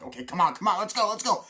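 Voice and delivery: high, loud rallying shouts; urgent hurrying. Retold or imitated. Friends hurrying off after crashing into the hotel, urgent hurrying. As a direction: hustle everyone along.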